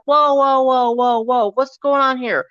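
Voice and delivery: loud, high alarmed whoa; calling out whoa. Retold or imitated. Store manager breaking up the argument, loud and alarmed. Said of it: "she's like".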